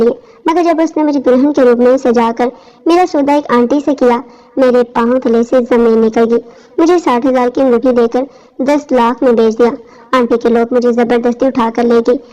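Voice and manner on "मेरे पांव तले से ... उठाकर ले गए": usual speaking voice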